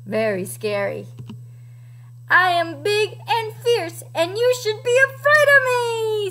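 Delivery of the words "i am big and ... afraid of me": higher-pitched, swelling dinosaur threat; storybook monster showing off; Tyrannosaurus. A narrator's reading. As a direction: build to a big boast, meant to frighten.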